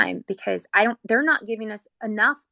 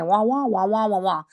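muffled wah-wah drone mimicking a trombone voice; a light mock of adult noise droning on; Charlie Brown teacher; 3 dB louder than usual. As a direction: imitate the garbled cartoon teacher sound. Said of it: "the teacher would say".

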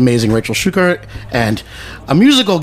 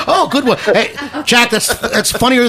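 laughing, delighted; 9 semitones higher, slower than usual. Real, not acted. Laughing at caller's joke.

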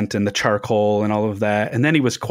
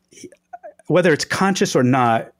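ordinary delivery

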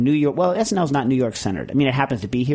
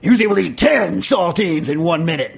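lightly hyped arena announcer; 4 semitones higher, 3 dB louder than usual. Acted. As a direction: call it out like a match announcer. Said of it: "you have to use a announcer voice for that".

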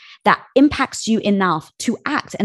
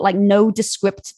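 ordinary delivery

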